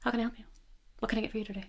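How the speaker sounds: soft, calm drive-through greeting; hushed, calm customer service voice; quiet calm. Retold or imitated. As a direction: speak softly so they have to lean in. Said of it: "if you keep talking quiet and calm".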